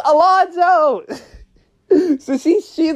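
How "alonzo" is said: high, drawn-out call of his name; pissed-off mom yell. Retold or imitated. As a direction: call out like a fed-up mother, long and high. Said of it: "like, yelling my name. Like, she's a pissed off mom".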